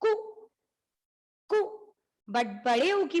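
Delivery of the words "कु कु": कु said short, vowel not stretched; a quick clipped कु. Shown as a demonstration. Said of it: "we say like this".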